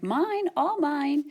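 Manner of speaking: high, slow, gloating storybook bear; greedy self-absorbed muttering, acted out; muttering to himself. A narrator's reading. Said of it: "as he muttered".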